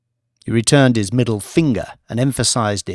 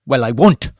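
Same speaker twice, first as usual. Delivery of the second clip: defiant refusal; 2 semitones higher, 6 dB louder than usual. A narrator's reading. Mamadou rejecting Banks' plan, raised and indignant. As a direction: push back hard, indignant.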